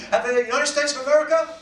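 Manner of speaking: loud, high shout-out to the crowd; calling out. Real, not acted. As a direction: holler to find the Americans in the room. Calling out to the audience.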